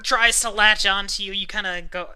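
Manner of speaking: ordinary delivery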